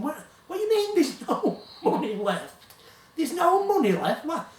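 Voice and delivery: mock alarm at the news; panicking disbelief. Narrator retelling his shocked reply to his colleague, mock panic. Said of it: "I'm panicking".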